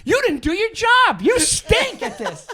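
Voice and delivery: high, loud telling-off; heated scolding. Retold or imitated. Comic retelling his comeback to the bombed friend, heated scolding. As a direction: lay into him, drawing out the end.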